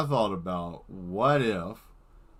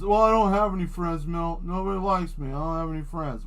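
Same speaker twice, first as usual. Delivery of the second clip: higher, whiny self-pity; mock whiny loner; 7 semitones higher, 4 dB louder than usual. Lonely viewer complaining to Mel, higher mock whine. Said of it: "it's always good to have friends".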